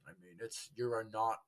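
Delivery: normal talking voice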